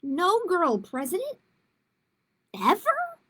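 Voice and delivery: loud, high rising disbelief; can't believe it, voice shooting up on ever. Acted. Grace disbelieving teacher.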